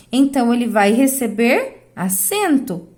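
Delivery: ordinary delivery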